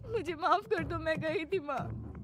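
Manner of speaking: high, pleading confession, slowing at end; tearful apology. Acted. Priya confessing to mother-in-law, pleading. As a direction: beg forgiveness in distress.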